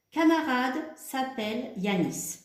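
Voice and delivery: slowly, word by word for the class; teacher reading out a sentence slowly; slow dictation. Shown as a demonstration. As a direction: dictate it slowly so pupils can copy. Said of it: "slow dictation of the sentence".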